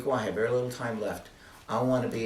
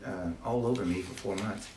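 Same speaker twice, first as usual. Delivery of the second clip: usual speaking voice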